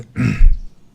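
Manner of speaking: one sharp, loud throat clear. Real, not acted. Civilization player with a hoarse morning voice clears his throat loudly. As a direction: clear the hoarse throat hard before going on. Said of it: "Excuse me".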